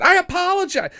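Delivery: high mocking whine; mock apology. Mocking the cart woman's apology in a high voice. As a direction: squeak it out like her.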